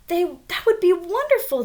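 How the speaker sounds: high, loud cheer of delight; excited shout. Acted. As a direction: shout it out like thrilled kids. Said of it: "they shouted".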